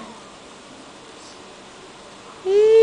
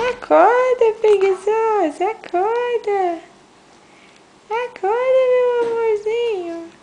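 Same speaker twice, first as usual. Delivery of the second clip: high sing-song coaxing; 5 semitones higher than usual. Real, not acted. Mother coaxing baby awake.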